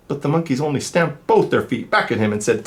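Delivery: lively, sing-song storybook reading; reading to children. A narrator's reading. Reader telling kids the peddler story, animated. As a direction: read it like to a class of little ones. Reads picture book aloud.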